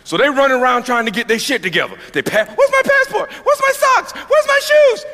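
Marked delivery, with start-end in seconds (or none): 2.5-5.0 very high, rising yelps of panic; hammed-up packing panic; frantic panic. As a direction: scramble in a shrill mock panic.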